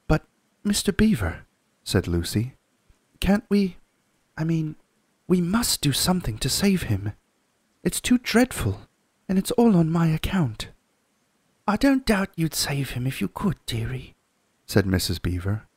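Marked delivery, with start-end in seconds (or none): 0.1-1.5 put-on lightly raised girl's voice, eager; young girl begging for the story; Lucy's voice. Lucy asking Mr Beaver, eager girl voice. 3.2-10.7 put-on high, distressed young girl; pleading girl. Lucy pleading with the beavers to rescue Tumnus, high girl voice. 11.7-14.2 put-on high, kindly old wife; Mrs Beaver. Mrs Beaver comforting Lucy, high motherly voice.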